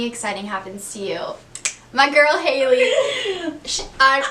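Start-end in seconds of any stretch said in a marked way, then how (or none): 1.9-4.2 laughing, louder and higher. Real, not acted. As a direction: gush about your best friend with a laugh. Excited about her best friend.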